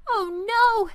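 put-on a boy's upset cry at his ruined plan; dismayed. Andy despairing over his mixed-up breakfast, high and dismayed. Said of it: "Andy said again".

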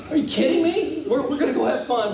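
high-pitched, mock-incredulous outburst; acted high exasperated disbelief; incredulous protest. Acting incredulous reply to wife.

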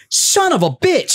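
high, drawn-out mock astonishment; stunned recognition. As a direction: stretch it out high as realisation lands. Retells fan's sudden recognition.

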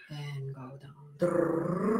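slow voice slide down to the bottom; vocal slide into the low range; sliding down low. Shown as a demonstration. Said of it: "find your lowest and your highest".

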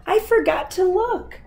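high-pitched storybook cry; yelled. A narrator's reading. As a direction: keep the spider's voice up high, calling out. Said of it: "yelled the spider".

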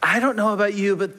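ordinary talk, nothing marked

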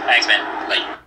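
ordinary talk

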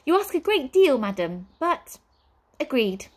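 high, prim, excitable manservant; fussy butler voice. Acted. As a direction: play the jittery butler. Butler relents.

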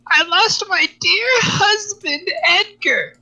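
put-on very high, slow, quavering old woman voice; old lady from Brother Bear. Friend reenacts a favourite movie scene, high old lady voice. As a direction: play the sweet widow grieving her husband, high and slow. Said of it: "one of my favorite scene is that".

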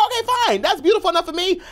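very high, laughing acceptance; high-pitched retold delight; giddy agreement. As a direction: squeal a giggly yes. Acting out his excited agreement.

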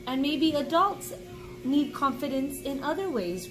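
normal talking voice

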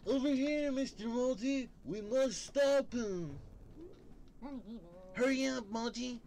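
a sidekick calling the hero to hurry, high and urgent. Acted. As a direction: squeak it out, pushing him to hurry. Voices game sidekick urging player.

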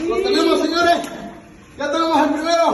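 high, loud triumphant call; calling from far away. Real, not acted. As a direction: call out the catch to the camera. Calling out after the catch.

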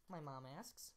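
ordinary delivery, nothing marked

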